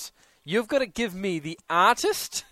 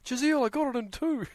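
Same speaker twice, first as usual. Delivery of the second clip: higher-pitched mock Kiwi voice; Kiwi accent; 7 semitones higher, 4 dB quieter than usual. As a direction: imitate a listener boasting in a put-on Kiwi accent. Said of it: "why you've got a Kiwi accent".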